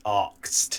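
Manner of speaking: "Asked" mimicked as drawn-out "arkst"; mimicked mispronunciation. Shown as a demonstration. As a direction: stretch "Asked" with K before S, "arkst". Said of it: "not asked".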